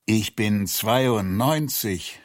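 usual speaking voice

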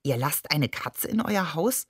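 plain reading voice

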